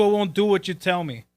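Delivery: ordinary talk, nothing marked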